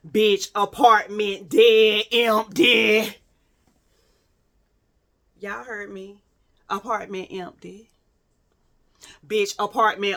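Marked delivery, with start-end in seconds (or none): 0.1-3.2 loud, drawn-out exclamation; emphatic reveal. Retold or imitated. Storyteller springs the empty apartment on viewers, loud emphatic reveal. 5.4-7.9 softer, deadpan aside for effect; hushed repeat. Retold or imitated. Storyteller lets the empty apartment sink in, quiet repeat.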